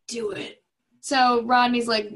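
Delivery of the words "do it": soft deathbed urging; hammed-up dying dad, fading; dying old father. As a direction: urge him on with a weak last breath. Dying father on phone, acted.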